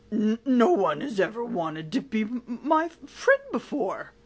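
loud, stammering, moved villain; tearful villain. Acted. Villain offered friendship at last, tearful. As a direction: choke up over the words. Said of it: "a great big shiny tear".

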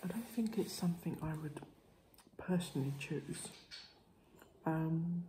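speaking between bites; talking with pastry at lips. Real, not acted. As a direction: mouth partly covered by food. Seen 1: pastry held against lips through all labelled frames, likely chewing.

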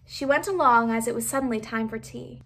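plain reading voice

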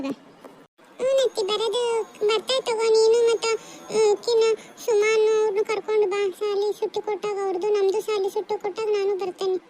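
high-pitched put-on child's voice; small kid character, pitched well up; schoolchild. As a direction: play a child replying to a departing relative.

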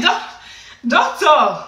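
loud, exasperated address to the doctor; indignant warning. Retold or imitated. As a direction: pull the doctor up sharply.

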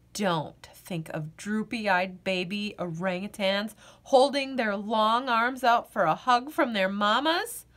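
plain reading voice

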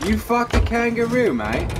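put-on high, loud jeering Australian accent; mock Australian accent. Firing an insult back at a crewmate in a mock Australian accent. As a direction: throw it back like a rowdy Aussie, Australian accent. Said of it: "you look like an Australian".

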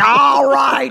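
high mock shout of irritation; imitated scolding. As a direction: yell it like a fed-up heckler, hammed up. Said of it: "He scolded me".

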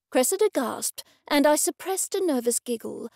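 plain reading voice

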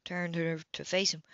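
plain reading voice

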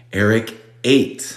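Eric ate said slowly, ate as a long a; slow model phrase. Shown as a demonstration. Teacher models the past tense slowly, stretching each word. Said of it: "teacher models past tense".

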